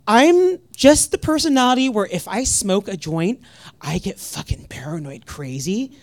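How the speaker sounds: ordinary delivery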